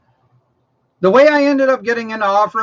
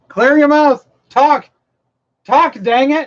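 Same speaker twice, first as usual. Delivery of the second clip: teasing shout; 7 semitones higher, 5 dB louder, slower than usual. Real, not acted. Teasing wife to talk.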